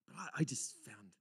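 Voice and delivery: unvoiced confiding whisper. Real, not acted. Intimate personal testimony.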